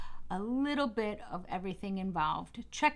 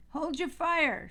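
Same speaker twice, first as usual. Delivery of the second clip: a higher, commanding call; bellowed; 7 semitones higher, slower than usual. Acted. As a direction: call off the shot urgently. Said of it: "Starr bellowed".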